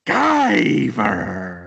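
yelling villain. Retold or imitated. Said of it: "and he's like".

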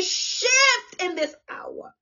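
high, long-drawn cry on "Shift"; soaring, drawn-out pulpit call; preacher's cry. Real, not acted. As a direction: stretch "Shift" out high and slow. Preacher cries out the key word.

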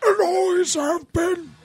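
very high, strained, drawn-out declaration; hammed-up last words with long pauses; mock dying farewell. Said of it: "And I'd say".

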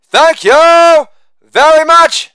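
high, slow [name] thank you. Acted. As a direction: drawl it like [name]. [name]-style sign-off.

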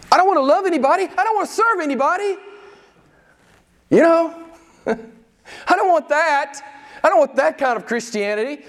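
high-pitched mock refusal; protesting churchgoer. Voicing the half-hearted churchgoer's refusal.

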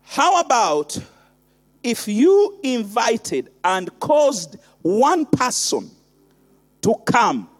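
intimate low sermon. Acted. Quiet intimate preaching stretch.

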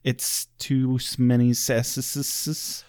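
"s-, many S's" drawn out slowly with hissing S sounds; Squirrely Dan. Retold or imitated. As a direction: stretch "S's" into a long sibilant "sɛsəs". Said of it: "you just kinda sound like Squirrely Dan".

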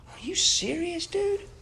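very high-pitched mock disbelief; retold reply, squeaking up in sheer incredulity; incredulous. Counselor to the complaining husband who wants instant forgiveness, mock incredulous.

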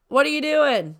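mother yelling up the stairs at son; calling out. Real, not acted.

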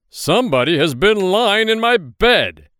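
loud, gruff growl of an outraged father bear; gruff Papa Bear. A narrator's reading. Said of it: "said Papa Bear in his big, gruff voice".

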